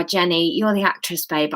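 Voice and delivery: plain reading voice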